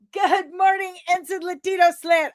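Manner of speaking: high, loud sing-song hello; cheery shout-out to a chat viewer; big shouted greeting. Real, not acted. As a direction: belt the good morning across the room.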